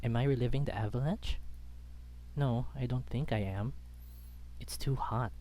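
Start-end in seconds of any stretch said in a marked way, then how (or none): none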